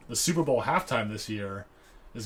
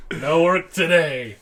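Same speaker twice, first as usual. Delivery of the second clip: slow, louder, drawn-out movie line; friend's impression of the character, slow and loud; 5 semitones higher, 8 dB louder, slower than usual. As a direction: savour each word of the quote. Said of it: "that's a good impression".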